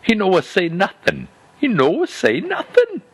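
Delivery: put-on high, broken-English Italian accent with comedic chagrin; Italian-accented disbelieving quip; thick Italian accent. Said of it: "say in that thick Italian accent".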